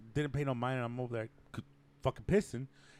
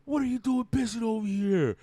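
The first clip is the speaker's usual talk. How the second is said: imitated angry guy; 7 semitones higher, 4 dB louder, slower than usual. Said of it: "and he's like".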